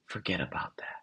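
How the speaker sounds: hushed, breathy secret to the listener; whisper. Real, not acted. Host urging the listener to drop perfectionism, in a whisper. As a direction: lean in and whisper it like a confidant.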